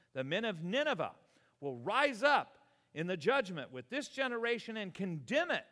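loud, high, driving preacher cadence; full-force preaching. Acted. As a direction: thunder the verses at the congregation.

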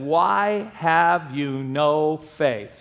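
very slow, drawn-out quoted question; grave rebuke. Retold or imitated. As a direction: stretch each word as a measured reproach.

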